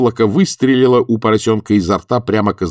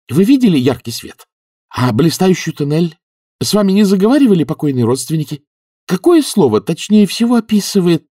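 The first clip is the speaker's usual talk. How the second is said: higher, louder barrage of questions; excited, insistent interrogation; eager questioning; 11 semitones higher, 6 dB louder than usual. Acted. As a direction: fire questions eagerly, pitched up. Eager questioning of revived man.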